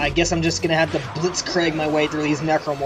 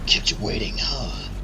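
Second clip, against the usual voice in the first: Solid Snake impression; 9 semitones lower, 3 dB quieter than usual. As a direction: rasp it out low and cool. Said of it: "That was actually pretty good".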